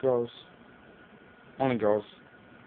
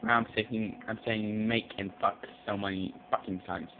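normal talking voice

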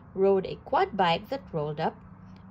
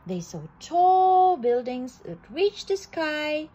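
ordinary delivery

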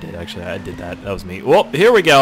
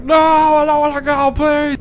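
put-on much higher, louder crying-baby plea; whiny baby; 5 dB louder, slower than usual. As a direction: beg like a clingy little kid. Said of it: "Like a little baby holding on to his mama".

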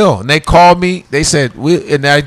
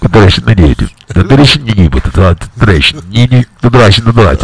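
mock Russian captain announcement, lower; fake Russian pilot; 5 semitones lower, faster than usual. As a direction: play a Russian pilot landing the plane. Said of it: "You're like".

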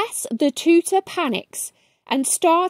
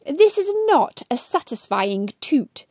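usual speaking voice

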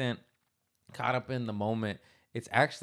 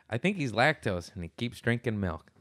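normal talking voice

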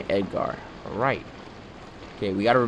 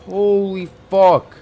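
slow, shaken high exclamation; drawn-out frightened curse; 11 semitones higher, 11 dB louder, slower than usual. Real, not acted. Horror game scare.

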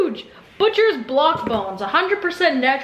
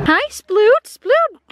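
high, sing-song greeting to the puppy; 5 semitones higher, 5 dB louder, much slower than usual. Real, not acted. Owner greeting her corgi in high baby talk. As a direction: coo his name up high.

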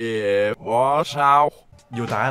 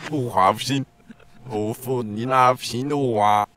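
backwards speech; 7 semitones lower than usual. Acted. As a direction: imitate a clip played backwards. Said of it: "你听我这个倒放". (倒放腔)

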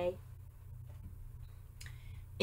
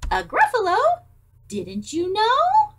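much higher, louder mock astonishment; surprised little mouse; 11 semitones higher, 7 dB louder than usual. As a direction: sound amazed he doesn't know.

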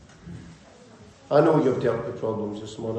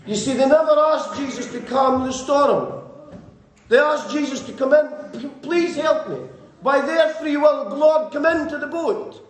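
raised, driving sermon voice at full force; fiery preaching; 10 semitones higher than usual. Acted. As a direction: preach it out to the congregation, pushing higher.

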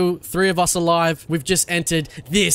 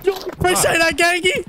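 high shouted greeting; 13 semitones higher than usual. Real, not acted. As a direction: call out his name excitedly. Greeting friend loudly.

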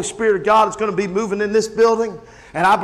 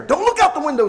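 mock urgent warning; 7 semitones higher than usual. Acted panicked warning to the kids.

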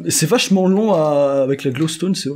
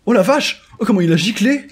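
amazed exclamation; 5 semitones higher than usual. Real, not acted. Player watching a mob get flung away, amazed outburst. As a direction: exclaim it high in disbelief.

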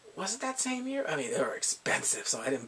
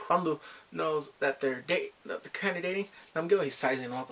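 ordinary talk

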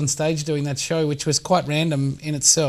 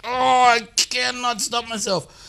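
slow, higher, drawn-out helpless confession; helpless drawl; 6 semitones higher, much slower than usual. Retold or imitated. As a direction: stretch it out as if powerless to look away. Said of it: "You're like".

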